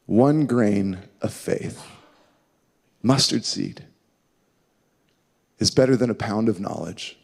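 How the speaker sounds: low, soft and slow with long pauses; slow, pondering repeat. Real, not acted. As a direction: let each phrase sink in. Repeats quotation slowly to let it sink.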